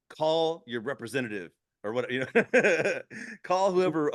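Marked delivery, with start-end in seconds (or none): 2.1-4.1 laughing. Real, not acted. Laughing mock rant.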